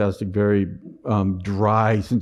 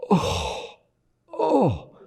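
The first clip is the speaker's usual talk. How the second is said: pained groan; 8 semitones higher than usual. Retold or imitated. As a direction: groan in mock pain at the chart. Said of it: "and they get pained".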